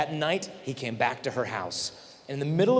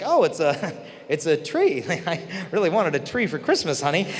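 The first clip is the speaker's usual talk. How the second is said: laughing, teasing; 3 semitones higher, 3 dB louder than usual. Retold or imitated. Girl's tree gift reaction.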